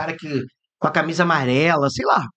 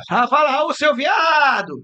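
mock yell; 9 semitones higher, 3 dB louder, much slower than usual. People calling out to Raul.

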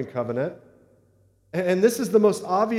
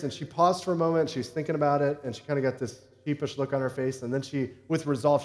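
usual speaking voice

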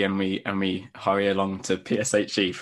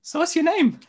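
much higher squeaky timid question; shy mouse; 3 dB louder than usual. Acted. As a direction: ask in a tiny high mouse voice. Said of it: "so I'm gonna be like".

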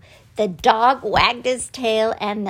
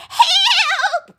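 very high, drawn-out shriek; witch's cry for help; 4 dB louder than usual. A narrator's reading. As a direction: shriek for rescue as the hunted witch. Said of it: "cried the witch".

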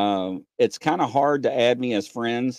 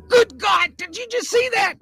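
much higher, louder amazed cry; excited shout; 5 dB louder than usual. Retold or imitated. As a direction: yell it like you just saw a fairy. Retelling seeing a fairy.

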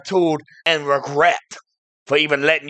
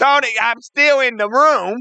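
indignant; 7 semitones higher, 3 dB louder than usual. Acted. Indignant interruption.

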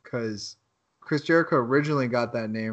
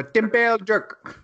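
higher, slightly Jamaican-sounding attempt; accidental Jamaican accent; 8 semitones higher, 3 dB louder, slower than usual. Shown as a demonstration. Trying out an Irish translation, in an accidental Jamaican accent. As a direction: try foreign words, landing near Jamaican. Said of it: "That sounds like a Jamaican accent".

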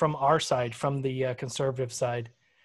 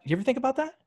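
high mocking voice; 11 semitones higher, much faster than usual. Mocking defiant anti-masker.

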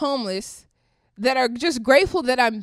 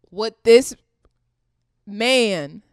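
ordinary talk, nothing marked

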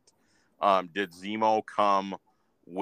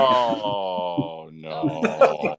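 long, drawn-out groaning "oh no"; mock dismay. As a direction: wail it slowly in playful regret. Mock dismay at a bad joke.

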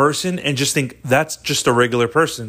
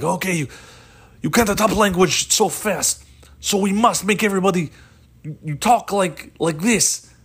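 higher-pitched mock Russian accent; 6 semitones higher than usual. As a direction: lay down the rule in a put-on Russian accent. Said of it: "now we speak Russian and just like this".

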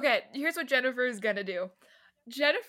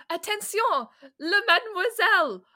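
higher, louder call across the field; yelling in French; 8 semitones higher, 5 dB louder, faster than usual. Acted. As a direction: shout to the French woman to get her attention. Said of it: "going to yell to the French love".

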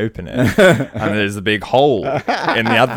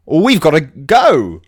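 higher, louder and slow eager call; 6 semitones higher, 7 dB louder, slower than usual. Acted. The boys rushing off to enter the contest, eager declaration. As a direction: announce it with big resolve. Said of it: "and they say".

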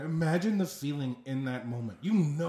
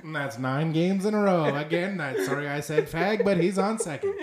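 play-by-play rising to a louder call; baseball voice; 2 semitones higher, 4 dB louder than usual. Acted. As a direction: keep announcing through the apology. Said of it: "think of the baseball voice".

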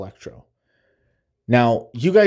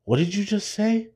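softer, threatening imitation; quiet menace; 7 semitones higher, 4 dB quieter than usual. Fan imitating angry Peter in the mask, quiet menace. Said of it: "he's just like".

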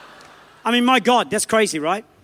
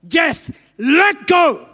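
slow, drawn-out shout, higher and louder; shouted command; 5 semitones higher, 6 dB louder, much slower than usual. Retold or imitated. Preacher acting Wigglesworth shouting at death. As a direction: bellow it at death, slowly. Acted command raising a corpse.

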